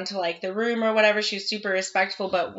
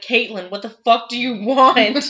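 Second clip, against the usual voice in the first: retold startled yell; 3 semitones higher, 8 dB louder than usual. Retold yelling at daughter.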